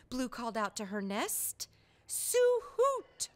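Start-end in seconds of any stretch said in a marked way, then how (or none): 2.1-3.3 high, bright owl voice announcing her name; a lightly chirped owl name; chirping owl. Acted. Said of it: "chirped the owl".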